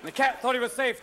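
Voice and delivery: ordinary delivery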